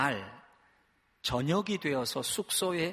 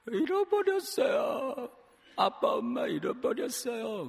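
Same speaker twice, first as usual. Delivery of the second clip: put-on crying lost child; 8 semitones higher, slower than usual. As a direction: wail like a lost little kid. (길 잃은 아이 흉내로 울부짖으며)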